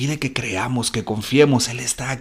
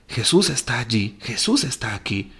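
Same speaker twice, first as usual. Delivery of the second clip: hushed; 2 semitones lower, 7 dB quieter than usual. Acted. Hushed reverent aside.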